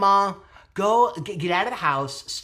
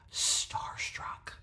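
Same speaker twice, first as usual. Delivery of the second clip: whisper; 9 dB quieter than usual. Real, not acted. Confessing being starstruck.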